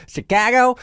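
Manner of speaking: "Chicago" hammed up high and loud in a Chicago accent; exaggerated Chicago accent. Shown as a demonstration. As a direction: show off the Chicago accent with a laugh. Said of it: "in the respective accents".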